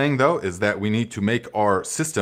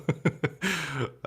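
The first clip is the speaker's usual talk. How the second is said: real laughter; 5 semitones higher, 7 dB quieter than usual. Real, not acted. Laughing at his own joke.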